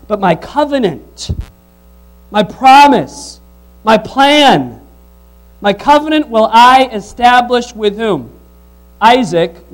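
loud, slow stress on each phrase; forceful preaching. Acted. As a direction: hammer each word of the covenant.

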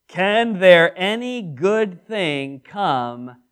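slow, drawn-out scoffing question; eye-rolling scorn. Acted. Nathanael scoffing at Nazareth to Philip, slow and scornful. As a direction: roll your eyes and drag every word. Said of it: "eyes rolling".

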